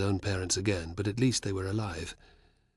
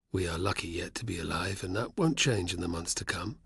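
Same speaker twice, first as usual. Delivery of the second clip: darkly; 3 semitones lower, 6 dB quieter than usual. Acted. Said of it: "said Navio darkly".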